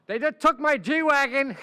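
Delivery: high, loud mock wail over the cars; a rich client complaining that agents are taking his cars; whining rich client. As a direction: whine about losing your Ferrari. Client whining about seized cars.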